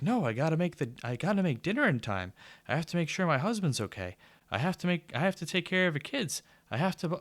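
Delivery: high, rapid, mechanical housewife voice; robotic Stepford wife. Retold or imitated. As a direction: rattle off chores like a programmed robot. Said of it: "acting like a robot".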